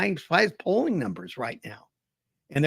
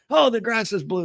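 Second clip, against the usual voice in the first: upset donkey insisting, higher pitched; a touch of animated indignation; animated insistence; 6 semitones higher than usual. Acted. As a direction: insist on it, worked up, mock argument. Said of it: "very animated".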